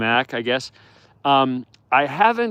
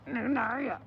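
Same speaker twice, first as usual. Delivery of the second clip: much higher, soft cooing nickname; 12 semitones higher, 9 dB quieter than usual. Real, not acted. Owner cooing a nickname to the pup. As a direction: coo the pet name up high. Pet name for the puppy.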